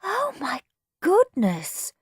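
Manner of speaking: slow, high, awed gasp. A narrator's reading. Peter seeing the gold gleam, gasping. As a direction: breathe it out in amazement. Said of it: "gasped Peter".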